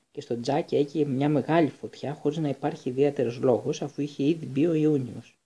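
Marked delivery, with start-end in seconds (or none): none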